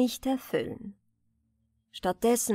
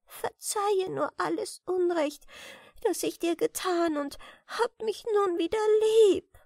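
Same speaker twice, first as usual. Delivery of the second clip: higher, pleading voice in tears; tearful plea; 10 semitones higher than usual. A narrator's reading. Sister begging her sibling to forgive her, tearful. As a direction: beg forgiveness through tears. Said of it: "unter Tränen gebeten".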